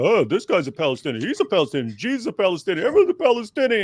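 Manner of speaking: mock them, rising to a silly peak; mocking imitation. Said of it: "He's making fun of saying".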